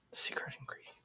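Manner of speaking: mock-emotional whispered aside. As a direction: breathe it out as if choked up. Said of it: "I'm getting all choked up".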